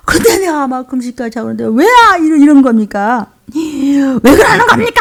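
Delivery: loud, whining protest, dragged out at the end; aggrieved grumbling. Acted. Believer who has fasted protests to God, aggrieved grumbling. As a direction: complain with mounting exasperation. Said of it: "푸념을 얘기하는 것이에요".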